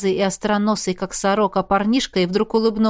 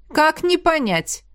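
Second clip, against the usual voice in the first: smirking; 7 semitones higher, slower than usual. A narrator's reading. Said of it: "ухмыльнулся темноглазый".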